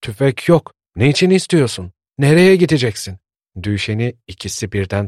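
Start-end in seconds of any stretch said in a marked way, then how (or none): 0.0-3.2 higher and louder, worried questions; anxious old folks blocking the door; rising alarm, holding him back; alarmed pleading. A narrator's reading. Old couple stops Düşen leaving.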